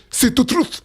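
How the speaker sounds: high-pitched, badly faked Nigerian accent; Will Smith's Nigerian accent. Host quoting a film at his friends, badly faked Nigerian accent. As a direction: do a terrible put-on Nigerian accent. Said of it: "in the words of Will Smith in Concussion".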